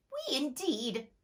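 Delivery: high, slow quivering mouse cry; trembling mouse. Acted. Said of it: "cried the mouse, who was trembling".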